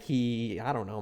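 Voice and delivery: normal talking voice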